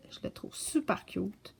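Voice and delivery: whispered confession. Real, not acted. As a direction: let it slip out in a whisper. Aside about an unstarted book. (murmure chuchoté)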